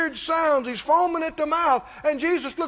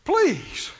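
begging; 4 semitones higher than usual. Retold or imitated. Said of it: "Even though the word beg is not here, can you hear it".

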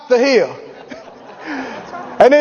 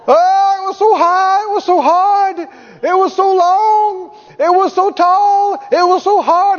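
high, slow, drawn-out lament about the mountain; milk every hardship like a martyr; whining testimony; 8 semitones higher, slower than usual. Retold or imitated. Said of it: "gets up and goes".

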